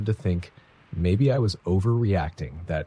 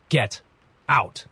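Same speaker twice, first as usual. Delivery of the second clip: higher order broken by a pause; halting, raised-pitch command; trembling; 6 semitones higher, 3 dB louder than usual. A narrator's reading. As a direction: force it out word by word, a touch of trembling. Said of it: "his voice trembling".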